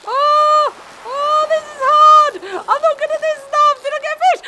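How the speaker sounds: high, loud panicked whine; frantic struggle. Real, not acted. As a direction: wail that you are losing. Struggles with fishing minigame.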